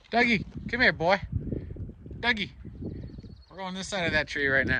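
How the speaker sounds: high, bright call to the pet; calling the dog. Real, not acted. Owner calling Dougie to come this way, high pet voice.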